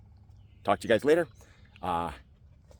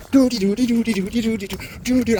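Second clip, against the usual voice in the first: high excited puppy talk; 9 semitones higher, faster than usual. Real, not acted. Hyping up dog.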